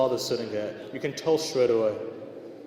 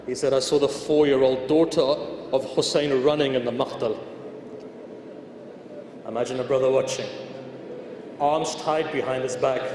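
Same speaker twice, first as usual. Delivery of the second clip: raised, pleading sermon voice; impassioned preaching lament; 3 semitones higher, 3 dB louder than usual. Acted. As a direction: preach the grief out to the hall. Preaching the lament at full force.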